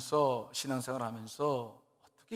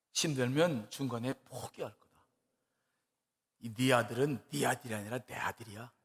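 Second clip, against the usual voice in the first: voice of the Holy Spirit; 5 semitones lower, 5 dB quieter than usual. Retold or imitated. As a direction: speak as God calmly reassuring a mother. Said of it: "그런 음성이 자기 안에 들어오더래".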